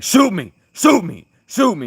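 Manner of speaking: high, loud yelled repeats; retold screaming. Retelling the fair kid yelling at the guard, loud and high. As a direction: scream it like the kid who snapped. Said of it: "And he's screaming".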